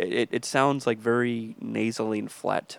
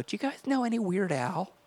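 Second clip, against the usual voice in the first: put-on geeky kid voice; 9 semitones higher than usual. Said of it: "I go".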